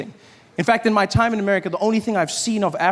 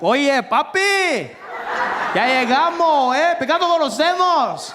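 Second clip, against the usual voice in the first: excited Puerto Rican guy; 8 semitones higher, slower than usual. Retold or imitated. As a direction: greet a long-lost brother, Puerto Rican style. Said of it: "some guy came up to me and he was like".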